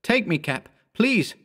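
high, desperate begging; urgent plea. A narrator's reading. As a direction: beg for rescue. Boy begs urgently.

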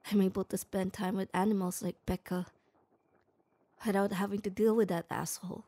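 normal talking voice

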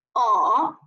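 slow 哦 dipping low, then rising; drawn-out dipping 哦; third tone. Shown as a demonstration. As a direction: bend 哦 down and back up. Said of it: "连贯的说一下".

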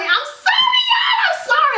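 very high mock-crying "I'm sorry, y'all"; a theatrical whiny sorry to viewers; hammed-up squealing apology. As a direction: wail the apology in a playful, high, shouted squeal. Hammed-up apology for too many products.

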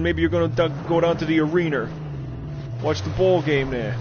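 mock Brooklyn accent. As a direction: play a Brooklyn local heading to the game. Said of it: "if you're from Brooklyn".

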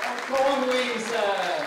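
loud, slow winner announcement; excited announcement. Real, not acted. Host announces winner to audience. (annuncio entusiasta, forte e lento)

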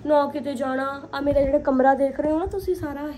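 ordinary talk, nothing marked

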